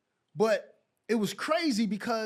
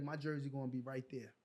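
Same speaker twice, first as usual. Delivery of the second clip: softly promising himself, lower and hushed; quiet vow to himself; 5 semitones lower, 11 dB quieter, faster than usual. Retold or imitated. Player recalling his vow under his breath, quiet. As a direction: murmur it like a private promise. Daily vow passing retired jerseys.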